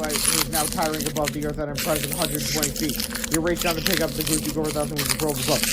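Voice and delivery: usual speaking voice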